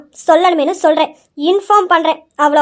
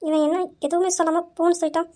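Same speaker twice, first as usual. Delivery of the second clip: muttered aside; 8 dB quieter than usual. Acted. Wife mutters aside after husband.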